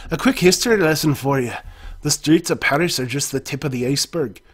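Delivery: lively put-on Scottish voice; Scottish accent. No-Nose giving the player a history lesson, Scottish accent. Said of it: "I'll be Scottish".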